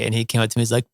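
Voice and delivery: ordinary talk, nothing marked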